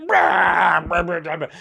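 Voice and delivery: mock-roar "rah" like a gamer losing it; imitated rage growls from a gamer roommate. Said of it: "get outwardly angry and be like".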